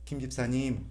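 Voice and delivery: the right way to address a deacon, soft and polite; polite gentle. Shown as a demonstration. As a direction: say it kindly with the honorific. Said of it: "이렇게 부르지 마시고".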